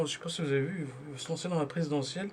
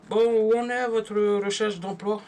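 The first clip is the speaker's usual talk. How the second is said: higher, louder, slow bureaucrat's question; mock official adviser; 6 semitones higher, 6 dB louder, slower than usual. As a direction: slowly ham up a pushy job counsellor. Imitating the adviser's check-up. (conseiller de Pôle emploi imité, plus aigu, plus fort et lent)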